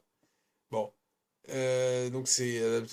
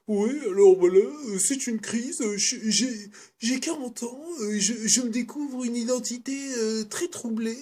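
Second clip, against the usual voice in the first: much higher and louder whiny lament; grumpy French auteur parody; 9 semitones higher, 6 dB louder than usual. Retold or imitated. Vlogger mocks old French filmmakers' midlife-crisis films, whiny and loud. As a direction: ham up a navel-gazing French director. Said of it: "de Français qui font".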